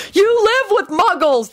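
high, stretched-out cry of exasperation; exasperated shout. A narrator's reading. The group protesting Lupin's complaint about his category, exasperated. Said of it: "the group shouted in exasperation".

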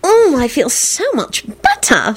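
high, slow confiding gush; mild stage confidence; conspiratorial. A narrator's reading. Solange confiding in Quentin, conspiratorial. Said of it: "Solange whispered conspiratorially".

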